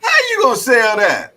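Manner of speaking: loud, high-pitched disbelief; incredulous outburst. Real, not acted. Challenging a friend who sold the truck, incredulous. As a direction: challenge him, voice shooting way up.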